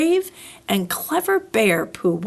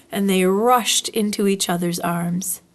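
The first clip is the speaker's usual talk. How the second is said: ordinary delivery, nothing marked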